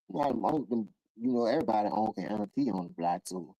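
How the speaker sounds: ordinary talk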